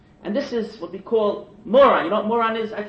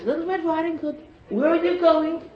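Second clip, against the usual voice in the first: wolf; 8 semitones higher than usual. Acted. Wolf voiced in mocked tale.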